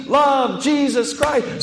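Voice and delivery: preacher crying out God's command; shouted. Acted. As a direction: belt it like a chant. Climax of the preaching.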